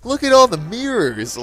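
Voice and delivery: put-on high, loud, drawn-out child's exclamation; excited kid. Niece spots the haunted house trick, excited. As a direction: imitate an awestruck kid calling out.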